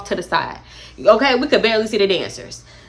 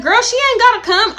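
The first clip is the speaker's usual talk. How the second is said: dismissive; 12 semitones higher, 5 dB louder than usual. Retold or imitated. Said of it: "So Dream is like".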